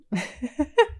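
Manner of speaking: a high bubbly giggle after the thanks. Real, not acted. Laughing, pleased after a listener compliments her.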